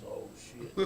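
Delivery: imitating an alarmed, whispered reaction; hushed alarm. Retelling Bear's wide-eyed reaction, hushed alarm. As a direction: mutedly act out a stunned whisper.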